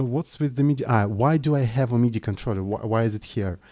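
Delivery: ordinary talk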